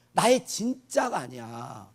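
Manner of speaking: ordinary talk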